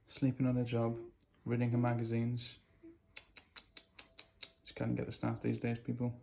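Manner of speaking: soft pretend-boss complaint; hushed mock grumbling. New dad finds his "employee" sleeping on the job, hushed mock grumbling. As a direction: grumble quietly, as if beside someone asleep.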